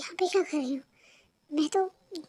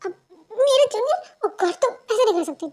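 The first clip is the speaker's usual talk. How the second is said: shocked; 5 semitones higher, 4 dB louder than usual. Acted. Boyfriend shocked by threat.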